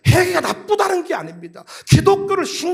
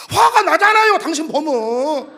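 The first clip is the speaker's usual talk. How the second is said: acted angry retort; 4 dB louder than usual. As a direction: blow up at the person being counselled. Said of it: "왜 성질 내세요". (버럭 화내는 말투)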